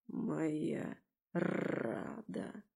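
low, slow, soft wolf growl; hushed, drawn-out beastly voice; rumbling purr. A narrator's reading. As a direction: rumble it possessively with rolled R. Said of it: "повторил он".

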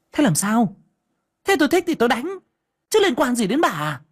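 high, defiant shout; aggressive retort. Acted. Daughter-in-law defying her mother-in-law, aggressive. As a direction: throw it in her face. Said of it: "sắn sổ hung hăng".

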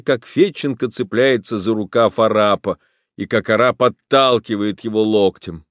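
plain reading voice

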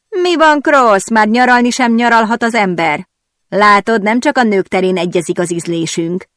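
mocking tease that starts high and loud; taunting. A narrator's reading. Harper taunting Patrick about sharing tastes, smug and mocking. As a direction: needle him with a smug grin. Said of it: "szekálta tovább Harper Patricket".

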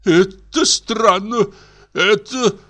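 high, slow, bewildered official; a stunned director drawing out his disbelief; astonished bank director. Acted.

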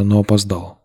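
ordinary delivery

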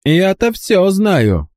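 high, slow, unhurried call; coolly shouted. A narrator's reading. Boy calling to the count, calm high shout. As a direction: call it out cool as ice. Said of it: "прехладнокровно крикнул".

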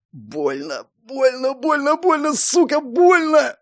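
rising, anguished repeated cry; pained moaning. Acted. Hero in pain inner voice.

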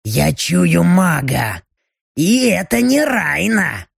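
slow, eager demon building to a shout; a demon scenting prey, thrilled; excited demon. Acted. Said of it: "В голосе демона слышался азарт". (азартный крик демона)

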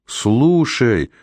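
оклик повыше, без настоящего крика; будто слегка перекрикивая мотор; громкий резкий оклик. A narrator's reading. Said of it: "закричал Раймонд громко и резко". (сдержанно изображённый крик)

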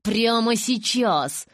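slow, high, forceful order; tense, drawn-out demand; through clenched teeth. A narrator's reading. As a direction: stretch each word, seething. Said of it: "Сквозь стиснутые зубы".

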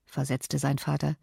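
ordinary delivery

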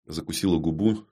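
ordinary delivery

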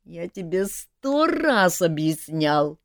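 slow, plaintive, moaning complaint; plaintive groan. A narrator's reading. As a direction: moan it out wearily. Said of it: "жалобно простонал Жак". (жалобно, медленно простонать)

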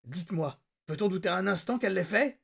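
insist, thrilled; excited demand. A narrator's reading. Grabbing technician, exultant.

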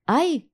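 sharp high yelp of pain; a sudden ouch, high; cry of pain. Acted. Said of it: "вырвалось от боли".

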